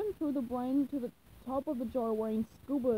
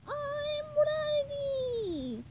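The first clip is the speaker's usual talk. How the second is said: much higher, very slow drawn-out cry; eager proclamation; much slower than usual. Acted. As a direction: announce it to the world. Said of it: "He proclaimed".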